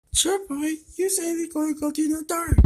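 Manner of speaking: high-pitched teasing cartoon voice; squeaky character voice. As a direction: tease like a tiny pet. Voices character answering back.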